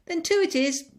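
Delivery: decide it out loud; big woman to herself. Acted. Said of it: "speaking to herself".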